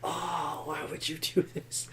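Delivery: soft, hurt, hushed; hushed anguish. Retold or imitated. Reacting to the April Fool's meme, hushed hurt. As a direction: say it hushed, wounded. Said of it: "I was like".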